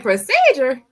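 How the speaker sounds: Procedure? hit loud with a falling disbelieving tone; incredulous echo. Retold or imitated. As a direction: repeat Procedure? like you can't believe it. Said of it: "I'm like".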